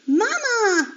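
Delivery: high, loud call. Acted. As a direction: call across the yard for Mama. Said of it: "Alfred called".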